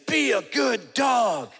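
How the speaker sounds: high, loud, drawn-out scolding; exaggerated stretched-out command; mock dog-owner shout. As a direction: yell slowly at the dog, hammed up. Man yanking his dog, quoted.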